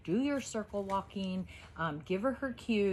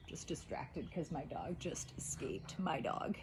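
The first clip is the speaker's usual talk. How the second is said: hushed whispered aside; 4 semitones lower, 9 dB quieter than usual. Real, not acted. Aside while dog escapes.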